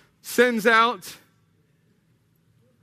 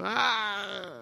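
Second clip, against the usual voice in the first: higher, long hesitant groan; drawn-out doubtful "ah"; 4 semitones higher, 4 dB quieter than usual. Acted. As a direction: waver over a box you can't tick. Acts out failing love on checklist.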